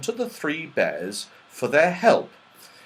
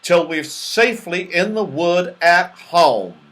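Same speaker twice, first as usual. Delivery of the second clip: loud, higher, emphatic ruling; decided; 6 semitones higher, 8 dB louder than usual. A narrator's reading. Moonface forbids leaving the cottage, decided. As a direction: lay down the law firmly. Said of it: "said Moonface decidedly".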